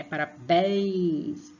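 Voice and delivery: "Parabéns" with a long, lingering "béns"; drawn-out stressed syllable. Shown as a demonstration. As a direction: hold the nasal end of "Parabéns". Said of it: "por causa do N".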